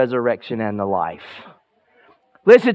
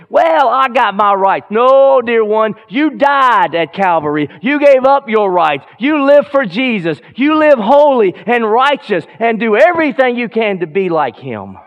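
higher and louder, building to a shouted Yes; full-force preaching; 7 semitones higher, 5 dB louder than usual. Acted. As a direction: preach it out with fire to the congregation.

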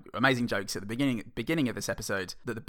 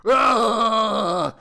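silly shout; 9 semitones higher, 10 dB louder, much slower than usual. Pretend. Everyone's loud silly reactions.

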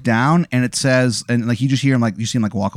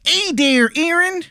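put-on high, terrible Baltimore accent; hammy Baltimore hun voice. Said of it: "the worst Baltimore accent that you have ever heard".

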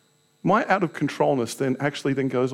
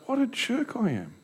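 rueful self-reproach; 8 semitones higher, 6 dB quieter than usual. Retold or imitated. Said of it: "I was like".

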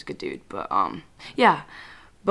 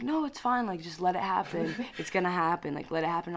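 calm mom voice; 8 dB quieter than usual. Retold or imitated. Mom calmly accepting fate.

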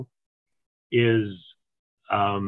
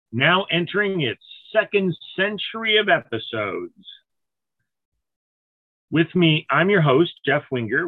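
podcast host intro; 4 semitones higher than usual. Acted. Host welcoming listeners to the podcast, upbeat announcer voice.

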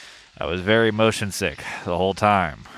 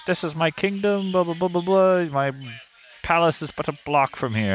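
high-pitched medieval peasant voice; squeaky old-timey peasant proclaiming grandly; 9 semitones higher than usual. Retold or imitated. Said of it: "the medieval peasant voice where he is like".

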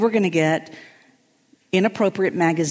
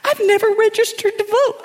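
much higher pleading whisper; higher guilty whispered plea; guilty whimper. Retold or imitated. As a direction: beg forgiveness in a tiny high voice. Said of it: "Claudia when she's guilty".

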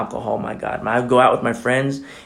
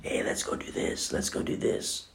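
tempting whisper; 13 dB quieter than usual. Retold or imitated. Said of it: "you'll have these dissenters".

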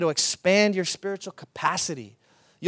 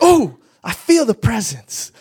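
higher, louder ecstatic cry with a laugh; spirit-filled exclamation; 4 semitones higher, 10 dB louder than usual. Retold or imitated. Hospital woman telling the pastor she feels God's presence, ecstatic and laughing. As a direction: imitate her swept up in the Spirit, laughing.

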